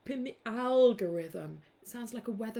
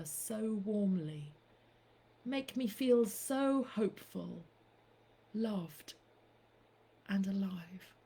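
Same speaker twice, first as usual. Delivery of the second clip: poem reading. Acted. Reciting an ode to Blind Boy, slow and hushed. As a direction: savour each word like a love poem. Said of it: "My last poem".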